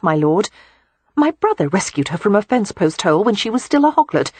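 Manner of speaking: chatty and open; lively young woman. A narrator's reading.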